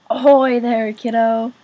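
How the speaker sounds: loud booming dad greeting. A narrator's reading. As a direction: boom it out across the room. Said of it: "boomed a deep voice".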